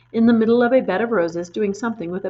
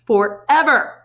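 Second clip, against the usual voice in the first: upset; 3 semitones higher, 6 dB louder, slower than usual. A narrator's reading. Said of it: "Matilda cried".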